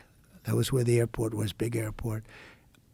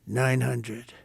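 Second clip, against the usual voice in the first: ordinary talk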